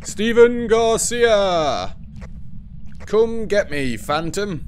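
drawn-out shout of the ghost's name; calling out loud. Real, not acted. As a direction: yell the name slowly, as a dare to the ghost. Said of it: "run through the door screaming its name".

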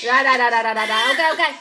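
loud soothing. Real, not acted. Mother to her crying baby, loud soothing.

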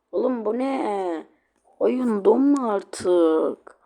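loud, slow yell at the frozen game; frustrated shout. Real, not acted. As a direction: drag out the plea in anger. Game keeps freezing, frustrated. (oyun donunca sinirle bağıran yayıncı)

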